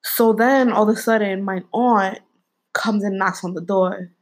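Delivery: ordinary talk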